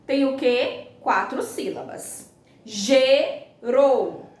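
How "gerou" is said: "Gerou" split into ge, rou, loudly; two separate punched syllables in "Gerou". Shown as a demonstration. Teacher separating syllables for the class, "Gerou" in two pieces. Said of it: "a separação silábica delas".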